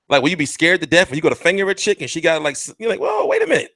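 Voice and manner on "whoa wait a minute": high, sudden whoa of surprise; a hammed-up shocked double take; startled whoa. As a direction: jump back in mock shock. Imagined startled reaction.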